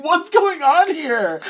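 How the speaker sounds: very high, loud, stretched disbelief; baffled outburst. Pretend. Coworker reacting to weird customers, baffled outburst. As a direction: exclaim it slowly in exaggerated bewilderment.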